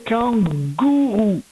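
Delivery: slow "kangourou", syllables held apart; split into syllables. Shown as a demonstration. Teacher sounding out the word for correction, split into syllables. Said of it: "Je devais faire la syllabe gou".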